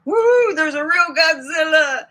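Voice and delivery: high, loud whoop; excited cheer. Real, not acted. Celebrating a yes about Godzilla, excited cheer. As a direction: whoop it out in triumph. Celebrates the answer she got.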